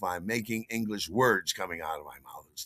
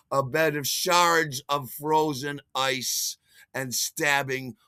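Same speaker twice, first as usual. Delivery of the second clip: plain reading voice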